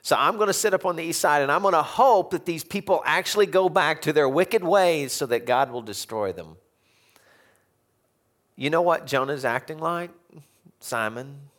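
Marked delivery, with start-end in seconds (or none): 0.0-6.6 higher, louder gleeful spite; hope for their ruin out loud; spiteful Jonah. Acted. Preacher voices spiteful Jonah.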